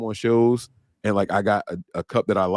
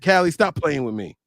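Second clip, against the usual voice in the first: higher, lively quote of her; Jack's voice; 8 semitones higher than usual. Retold or imitated. Host quoting Jack's message on air, imitating her higher voice. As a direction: mimic her telling someone off. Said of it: "She said".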